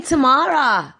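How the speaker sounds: high, drawn-out name call; mock shout. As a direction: imitate calling for a sister in a shop. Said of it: "whilst I'm shouting out Tamara".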